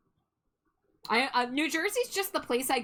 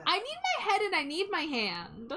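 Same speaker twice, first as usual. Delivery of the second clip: squeaky little cartoon voice, much higher; high squeaky character voice; 9 semitones higher than usual. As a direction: play a tiny scared egg. Egg character pleading.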